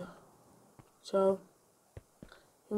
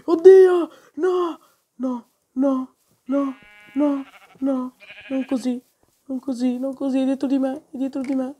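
being chased; 5 semitones higher, 9 dB louder, slower than usual. Real, not acted. Chased by monster in game.

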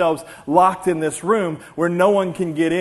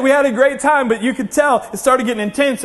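ordinary talk, nothing marked

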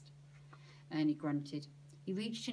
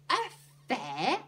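louder, halting wondering question; amazed; 5 semitones higher, 8 dB louder than usual. A narrator's reading. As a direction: break it in two with astonishment. Annie and Stan amazed at fairground.

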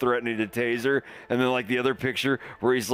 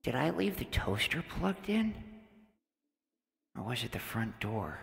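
much softer, fretting under his breath; worried muttering; 6 semitones lower, 8 dB quieter than usual. Acted. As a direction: murmur as if lost in thought. Mock-worried mumbling to himself.

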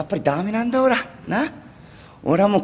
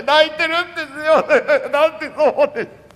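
laugh-crying; 6 semitones higher than usual. Acted.

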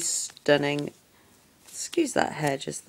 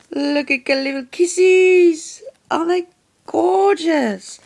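put-on much higher, slow, sugary baby voice; squeaky, doting baby talk, a bit louder; cutesy baby talk; 11 semitones higher, 6 dB louder than usual. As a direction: coo over them like a tiny puppy. Gushing over tiny kiss charms.

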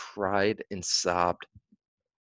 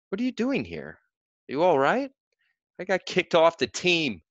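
alarmed mother; 8 semitones higher, 4 dB louder than usual. A narrator's reading.

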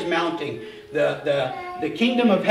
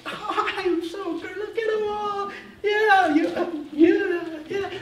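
high, mocking preening diva voice; vain diva; 12 semitones higher, slower than usual. Preacher mocking a vain Jesus lapping up the crowd, high preening diva voice. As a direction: play a smug star soaking up applause. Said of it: "he's not the diva going".

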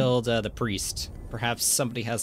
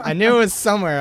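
startled; 7 semitones higher, 10 dB louder, faster than usual. Real, not acted. Startled by jump scare.

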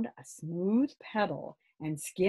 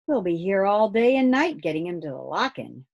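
a touch of grumbling, a little louder; mild growl; 3 semitones higher, 7 dB louder than usual. Acted. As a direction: complain gruffly but lightly. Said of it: "growled Grumble".

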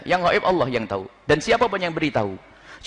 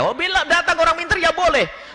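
quoting the Buya; 12 semitones higher than usual. Retold or imitated. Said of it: "Buya, gimana kalau kita".

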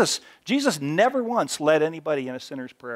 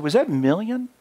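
disbelieving question; 6 semitones higher than usual. Retold or imitated. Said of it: "I said".

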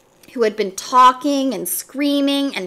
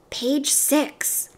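soft, hushed answer; as quietly as possible; 4 semitones higher, 5 dB quieter than usual. A narrator's reading. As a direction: keep it down so the teacher won't hear. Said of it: "as quietly as he could".

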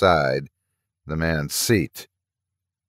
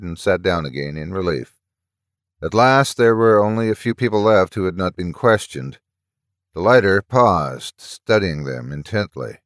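ordinary delivery, nothing marked

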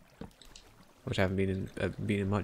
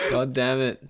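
ordinary talk, nothing marked

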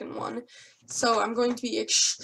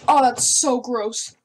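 loud, slow grossed-out groan; disgusted; 9 dB louder, slower than usual. Real, not acted. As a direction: drag it out in disgust. Disgusted by taste.

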